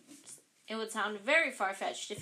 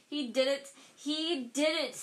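louder and higher, furious accusation; spat in fury; 5 semitones higher, 5 dB louder than usual. Acted. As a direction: Filch spitting the blame at Harry. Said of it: "Filch spat".

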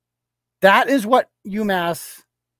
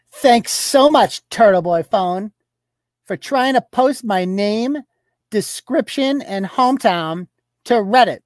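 mocking tweet read; 4 semitones higher than usual. Blogger reads out her complaint to viewers, mock outrage. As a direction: read out her tweet high and indignant, drawing words out. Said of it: "This is what she writes".

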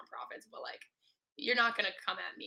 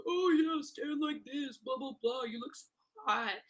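high, put-on mocking voice; mocking imitation; 5 semitones higher, 4 dB louder, slower than usual. Imitating passers-by making fun of their photos, mocking. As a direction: imitate hecklers jeering in a higher voice. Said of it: "making fun of us".

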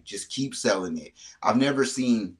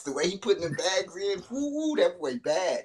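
hyped praise; 10 semitones higher than usual. Real, not acted. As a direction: rave about it. Hyping up the bagpiper.

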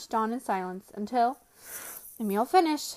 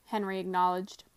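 usual speaking voice

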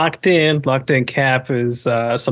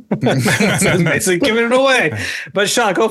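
laughing; 6 semitones higher than usual. Real, not acted. Host laughing at the request.

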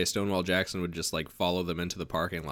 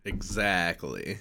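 usual speaking voice

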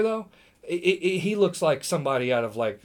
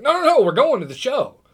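higher and louder, quick no no no; eager protest; 8 semitones higher, 7 dB louder than usual. Retold or imitated. Newlywed fan insists to Sheamus they're attending, eager and loud. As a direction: hurry to set him straight.

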